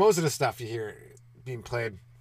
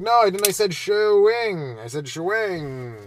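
loud denial with a drawn-out cover word; mock dad insisting, stretching the fake word slowly; defensive dad; 7 semitones higher, 8 dB louder, slower than usual. Dad covering up to his kids, loud mock protest.

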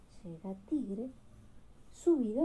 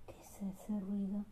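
whisper; 3 semitones lower, 7 dB quieter than usual. A narrator's reading. Suspense in a bedtime story.

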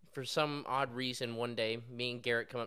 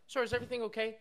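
high sugary customer-service voice; mock concern, sweetly; fake polite; 9 semitones higher, faster than usual. Fake-polite cashier teasing customer.

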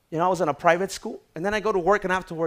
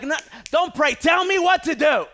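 high, loud, pleading shout; frustrated shout; 11 semitones higher, 9 dB louder than usual. Retold or imitated. Son shouting back at his dad for real advice, loud and high. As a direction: demand an answer at the top of your voice. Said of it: "I'm like".

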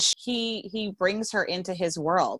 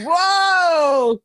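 high, loud, drawn-out whoa; real delighted amazement; impressed whoa; 10 semitones higher, 10 dB louder than usual. Real, not acted. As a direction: let out a big wowed exclamation. Listener reacts to the steamy excerpt.

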